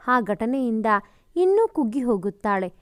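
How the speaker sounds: usual speaking voice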